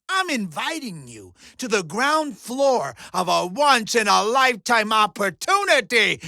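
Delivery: normal talking voice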